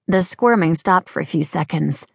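ordinary delivery

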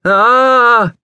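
long, loud, high "ah"; drawn-out wild cry of pain; wild yell. A narrator's reading. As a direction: scream out wildly as if scalded. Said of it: "Vern expostulated a wild".

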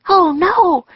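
high, loud dismay; alarmed cry. A narrator's reading. Annie seeing ninjas dash toward the tree, alarmed cry.

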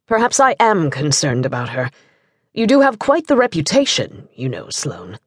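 smooth retort, sinking very low at the end; pointed gentlemanly comeback, dropping low. A narrator's reading. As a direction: turn the jab back on him. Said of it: "their guest retorted".

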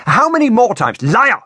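high, loud, lashing accusation; cracked-out accusation. A narrator's reading. Woman accusing her bound captive, loud cracking anger. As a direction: crack the words out like a whip. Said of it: "the woman's voice cracked out".

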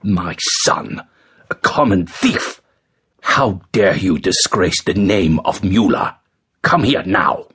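understated angry father, no shouting; contained outrage in a father's voice; exploding in anger. A narrator's reading. Father confronting his thieving son, restrained explosion of anger. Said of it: "his father exploded".

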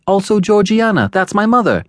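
put-on high-pitched, anxious boy explaining; timid little boy's high voice. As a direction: explain nervously as a small child. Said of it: "I timidly explained".

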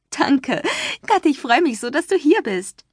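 high, gushing thanks; excited joy. A narrator's reading. Taylor thanks her friend Cassie, high and gushing. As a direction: bubble over with happiness.

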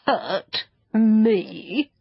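affronted creature, drawing out each word; indignant. Acted. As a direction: echo it back in offended disbelief. Creature offended by children.

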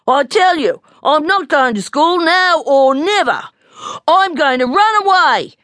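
put-on high, loud childish declaration; defiant little bear. A narrator's reading. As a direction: declare it stubbornly, voice pitched right up. Little bear defiantly announces running away.

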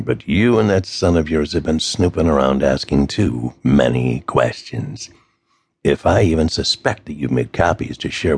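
low, gravelly threatening voice; a cold kidnapper laying down terms; gravelly phone villain, low and menacing. A narrator's reading.

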